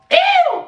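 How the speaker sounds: sudden high, loud "ew" squeal of disgust; shrill disgusted squeal at covid behavior. Real, not acted. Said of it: "disgusted reaction".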